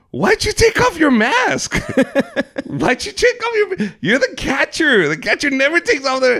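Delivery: incredulous high yelp of a coach; scolding coach. Retold or imitated. Acts out coach scolding him.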